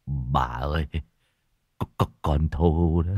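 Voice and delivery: low, slow, faltering voice; tearful. Acted. Husband breaking bad news to his wife, tearful and halting. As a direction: choke out the name, stammering. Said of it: "nước mắt lưng tròng".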